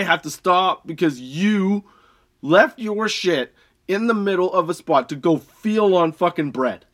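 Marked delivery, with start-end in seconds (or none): none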